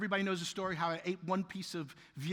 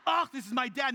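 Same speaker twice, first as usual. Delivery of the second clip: grossed-out daughter; 6 semitones higher, 5 dB louder than usual. Retold or imitated. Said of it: "my daughter is grossed out".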